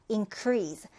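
increase with the weight on CREASE, in-CREASE; stress on increase. Shown as a demonstration. Teacher modelling the verb stress of increase. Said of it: "디듬절의 강세가 올 때는 동사입니다".

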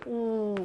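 "우" held out long; sustained demonstration of "우"; long vowel. Shown as a demonstration. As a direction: draw "우" into a soft, long oo. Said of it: "길게 하니까요".

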